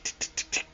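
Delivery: soft, voiceless clicking ticks; imitated picking ticks. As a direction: mimic a quick dental pick with tongue ticks. Imitates doctor quickly picking at teeth.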